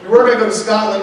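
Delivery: ordinary talk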